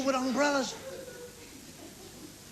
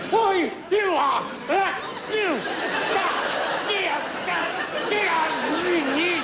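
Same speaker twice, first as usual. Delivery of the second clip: sputtering fury; 5 semitones higher, slower than usual. Retold or imitated. Confronting driver, lost for words.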